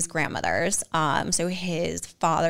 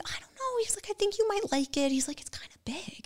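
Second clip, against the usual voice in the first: high, soft, tentative whisper; shy hushed quote; 8 dB quieter than usual. Retold or imitated. Quoting fiancé about the ring, high soft whisper. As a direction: voice him coaxing her, barely audible.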